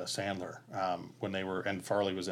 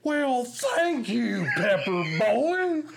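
much higher, much louder, very slow booming voice; transformed Farley bellow; 14 semitones higher, 7 dB louder, much slower than usual. Retold or imitated. Retelling how Farley transformed the line, a booming slow bellow. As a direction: blow the line up huge and drawn out. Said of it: "he just transforms".

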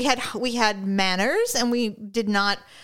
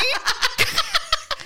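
laugh. Real, not acted. Laughs mid proclamation.

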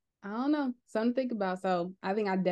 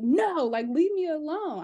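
put-on whiny toddler refusal; 9 semitones higher, 5 dB louder, slower than usual. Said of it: "I mean, she's not saying, "Leave me alone".

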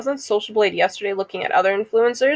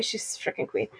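breathy whispered aside; 15 dB quieter than usual. Real, not acted. Praising her friend to her co-host in a whisper. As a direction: whisper it like a secret compliment.

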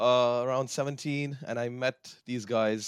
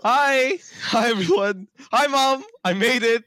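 high, loud mock-starstruck greeting; playfully excited; 11 semitones higher, 7 dB louder, slower than usual. Guest greeting Mom on the podcast, playfully excited. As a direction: call out giddily to the folks at home.

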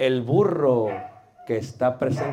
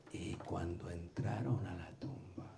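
much lower, much softer, near-whispered; sudden hush; 9 semitones lower, 21 dB quieter than usual. Shown as a demonstration. Teacher showing a tone drop in the tomb story, hushed. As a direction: drop it down low to draw them in.

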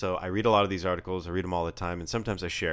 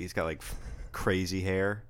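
ordinary delivery

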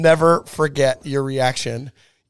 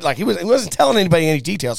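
usual speaking voice

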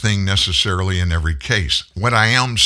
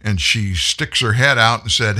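ordinary talk

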